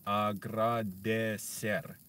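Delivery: slow, careful Spanish agradecer with a tapped r. Shown as a demonstration. Learner drilling Spanish words on stream, saying agradecer slowly. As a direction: sound out agradecer syllable by syllable. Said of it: "you always say it wrong".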